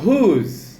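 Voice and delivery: "Whose" said singly and drawn out; model pronunciation. Shown as a demonstration. Teacher to class introducing the word, "Whose" as clear model.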